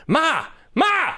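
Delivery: imitated high-pitched holler for mom; aggressive yell. Grown son calling his elderly mother ahead, mock aggressive high yell. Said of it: "how aggressively he yelled for his mom".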